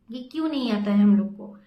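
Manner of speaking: loud, irritated imitation of the attendant; angry attendant. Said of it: "उसका दिमाग खराब हो गया".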